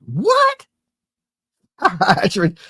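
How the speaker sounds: startled squeak of disbelief; shocked. Real, not acted. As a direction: jump up in pitch, disbelieving. Hearing of fire hydrant tax.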